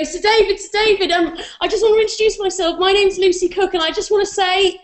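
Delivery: high, eager fan voice; overexcited fan accosting her idol; starstruck gushing. Retold or imitated. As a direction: gush at your hero, breathless with nerves. Gushing fan greeting hero.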